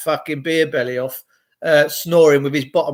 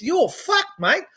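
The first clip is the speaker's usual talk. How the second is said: much higher mock Aussie, rising at the end; squeaky Australian send-up; Australian accent; 11 semitones higher, 3 dB quieter, slower than usual. Vlogger mocking how Australians curse, high Aussie accent.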